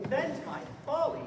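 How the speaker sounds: loud, high mocking echo of his word. Clown loudly mocking the stranger's fancy word, scornful echo. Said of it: "He has heard that word of some great man".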